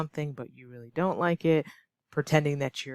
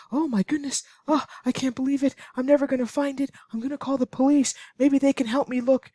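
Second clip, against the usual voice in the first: high, loud panicky wail over lost keys; overly dramatic panic; 10 semitones higher, 4 dB louder, faster than usual. Acted. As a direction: ham up the panic like it's a disaster. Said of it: "You're so dramatic".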